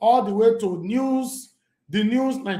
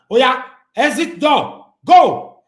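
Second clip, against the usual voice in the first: louder, slow dismissive order; mock threat; 7 semitones higher, 10 dB louder, much slower than usual. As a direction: bark him toward the exit door.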